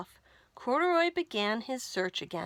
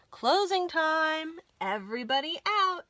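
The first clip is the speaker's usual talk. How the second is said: calling out closing time; 6 semitones higher than usual. Acted. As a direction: call everyone out like a shop manager at closing. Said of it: "the manager of the laundromat called".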